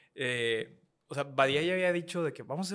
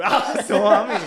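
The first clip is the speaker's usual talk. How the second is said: laughing; 6 semitones higher, 12 dB louder, much slower than usual. Real, not acted. Burst of laughter.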